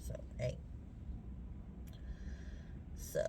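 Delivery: breathy hushed whisper. Real, not acted. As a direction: drop to a secret whisper. Sudden hushed aside.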